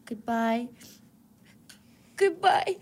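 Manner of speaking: tearful goodbye; grand tragic wailing. Acted. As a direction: sob the farewell. Dramatic farewell with crying.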